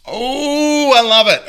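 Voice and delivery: real, high-pitched thrill; delighted squeal. Real, not acted. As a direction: gush with delight, high and loud. Delighted reaction while making drink.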